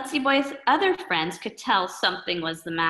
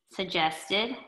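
usual speaking voice

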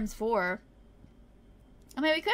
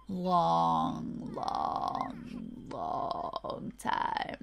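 LONG stretched out, sinking lower and softer; LONG repeated slowly, fading into a very low murmur; drawn-out long; 9 semitones lower, 4 dB quieter, much slower than usual. Real, not acted. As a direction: drag each LONG out until the voice drops away. Drags word to mock slowness.

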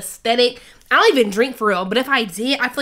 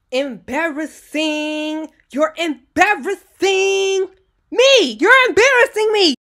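mock whine; 6 semitones higher, slower than usual. Teasing herself for misreading, mock whine. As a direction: drag it out like a dramatic teen. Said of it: "so I can read it".

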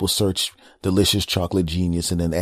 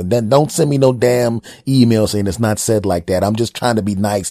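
loud, exasperated mini-rant; mock indignation; 4 semitones higher, 7 dB louder than usual. Host scolding nitpicking emailers, loud mock indignation. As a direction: raise the voice in comic protest.

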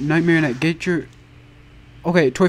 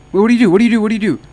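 panicked; 6 semitones higher, 8 dB louder, much faster than usual. Real, not acted. Panicking at game mechanic.

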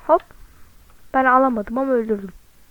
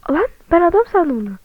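surprised; 6 semitones higher, 6 dB louder than usual. Real, not acted. Startled by a costume.

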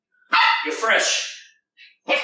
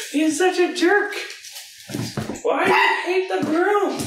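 very high playful pet talk; high teasing pet voice; high dog-talk voice; 14 semitones higher than usual. Real, not acted.

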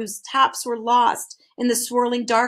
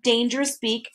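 ordinary delivery, nothing marked

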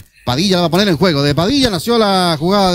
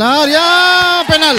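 excited call; 8 semitones higher, much slower than usual. Real, not acted. Rising call of a penalty.

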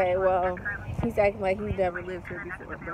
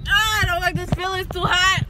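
complaining; 8 semitones higher, 8 dB louder than usual. Real, not acted. Reacts to the heat.

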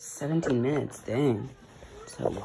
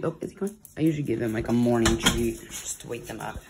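normal talking voice

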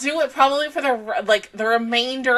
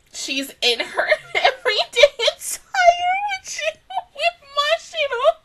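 higher, crying and laughing at once; teary excitement; 13 semitones higher than usual. Real, not acted. Fan gushing about a character's outfit, teary excited laugh. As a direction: let the joy crack your voice.

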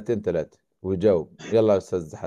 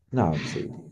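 deep servant voice; 6 semitones lower, 4 dB quieter than usual. Acted. Phone answerer role, lower.

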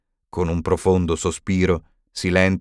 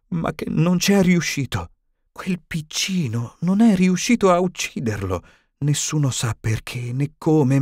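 higher, mildly tremulous woman's voice; trembling voice; 7 semitones higher than usual. A narrator's reading. Witch passing on frightening rumours about the Potter boy, mildly trembling. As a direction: let the worry shake the words a little. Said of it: "con voce tremante".

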